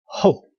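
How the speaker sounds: "Ho" pitched downward; falling fourth tone on "Ho". Shown as a demonstration. Teacher sounding out a greeting word, "Ho" falling. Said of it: "that's a downward, also the fourth sound".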